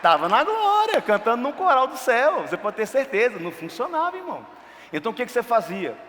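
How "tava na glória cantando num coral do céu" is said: mock exclamation. Joking he would be dead.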